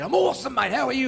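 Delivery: high-pitched mock-hearty reply; hearty greeting. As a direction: toss back an over-cheery pub hello. Acts out his hearty reply.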